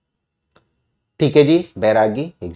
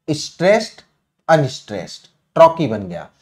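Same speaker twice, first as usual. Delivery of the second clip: teacher acting out stressed then unstressed; stress pattern demo. Shown as a demonstration. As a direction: rise on stressed, fall on unstressed. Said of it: "stress plus unstress".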